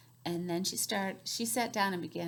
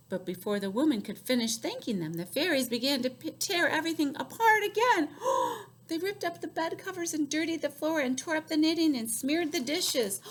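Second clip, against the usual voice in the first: usual speaking voice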